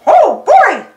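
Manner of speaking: Mickey Mouse voice. Acted. Mickey reads Minnie's note, high, excited mouse voice. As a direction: pipe up like Mickey finding a fun note. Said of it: "says Mickey".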